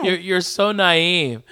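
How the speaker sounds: ordinary talk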